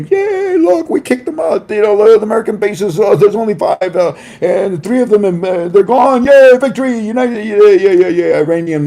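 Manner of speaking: high, excited crowd chant, half sung; mock celebrating. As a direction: cheer like a gloating crowd. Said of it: "that were just singing".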